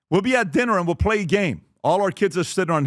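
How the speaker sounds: ordinary talk, nothing marked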